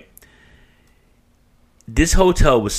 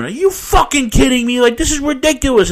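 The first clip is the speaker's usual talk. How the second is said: high, loud outraged yell; screaming; 6 dB louder than usual. Retold or imitated. Customer to arena guard over locker fee, screaming. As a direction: re-enact flipping out at full pitch. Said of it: "now I'm screaming".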